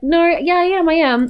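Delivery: high, nervous little admission. Acted. Kalani nervously admitting her pregnancy to Malik, high and hesitant. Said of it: "she got kinda nervous".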